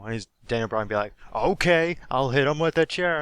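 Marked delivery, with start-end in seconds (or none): 1.3-3.2 higher and louder, hammed-up eager yes; mock eager agreement. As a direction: play the wrestler gladly agreeing, a bit over the top. Daniel Bryan voiced in retelling.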